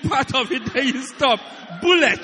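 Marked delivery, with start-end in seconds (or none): 1.8-2.2 loud, high punch on bullet; shouted punchline. Acted. Preacher shouts the joke word at the congregation, loud.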